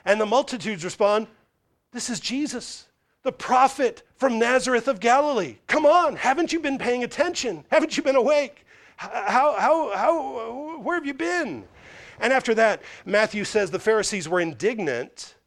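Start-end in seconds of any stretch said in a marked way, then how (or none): 1.9-11.7 exasperated crowd. Acted. Multitudes exasperated at critics.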